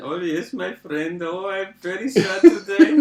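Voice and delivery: slow, high mock Indian accent. Shown as a demonstration. Co-host shows off a sad caller, Indian accent. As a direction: lament slowly in a put-on Indian accent. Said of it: "Oh, the Indian ones are easy".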